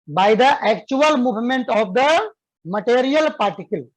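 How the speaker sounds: slow, clear dictation for students to write. Shown as a demonstration. Teacher dictating a definition to students, slow and clear. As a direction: spell it out slowly so they can copy. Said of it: "लिखो बाबू".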